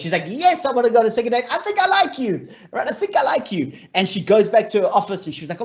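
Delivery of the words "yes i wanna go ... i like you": giddy yes. Retold or imitated. She accepts the second date.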